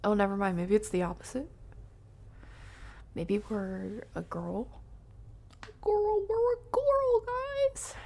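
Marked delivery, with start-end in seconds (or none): 5.8-7.9 surprised squeal. Real, not acted. Streamer squeals at chat over her new character. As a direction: squeal it, much higher and louder. Discovers game character is girl.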